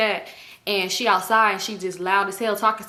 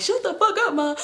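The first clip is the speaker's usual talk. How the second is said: mock crying yell; 10 semitones higher than usual. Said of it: "So he crying like".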